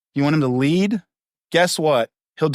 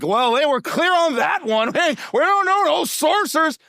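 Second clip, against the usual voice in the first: mock churchgoer denial; 9 semitones higher than usual. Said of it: "You're like".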